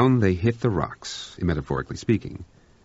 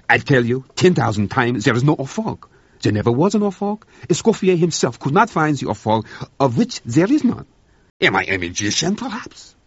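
louder, higher, a French cook losing patience; insist, arms waving, fed up; exasperated protest; 7 semitones higher, 6 dB louder than usual. Acted.

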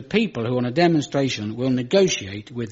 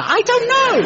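mock helpless squeal; 6 dB louder than usual. Mock-helpless high cry.

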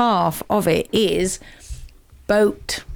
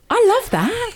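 real laugh, pitched way up; laughing delight; 13 semitones higher than usual. Real, not acted. Delighted laugh at pupil's joke.

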